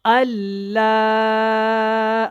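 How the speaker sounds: slow, drawn-out recitation with clear letters; careful tajweed recitation. Shown as a demonstration. Teacher demonstrating the kalima to learners, slow tajweed recitation. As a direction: recite slowly, stretching each word. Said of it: "بہت دھیان سے اس کو سیکھیں".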